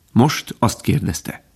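ordinary delivery

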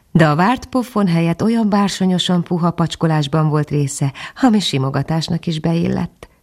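ordinary delivery

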